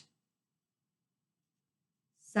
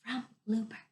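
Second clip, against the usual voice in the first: whispered; 6 dB quieter than usual. Acted. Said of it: "whispered Lena".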